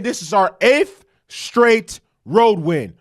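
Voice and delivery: slow, stress on "eighth"; slow emphatic statement. Shown as a demonstration. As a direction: drive "eighth straight road win" home slowly. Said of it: "Let me emphasize again".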